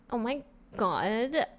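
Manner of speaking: put-on slow, drawn-out valley-girl drawl; bratty, catty. Shown as a demonstration. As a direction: stretch it out like a catty California girl. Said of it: "One is innocent and one is bratty".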